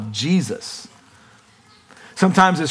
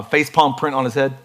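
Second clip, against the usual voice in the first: ordinary delivery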